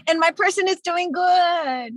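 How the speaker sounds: high, loud and drawn out; joyful announcement. Real, not acted. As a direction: burst out with good news. Shares happy news of loved one.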